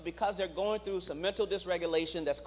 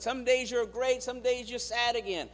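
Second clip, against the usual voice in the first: higher, nagging friend voice; exasperated onlooker; 6 semitones higher than usual. Retold or imitated. Friends complain about mood swings, high exasperated voice. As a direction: needle them from up high. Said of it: "They say".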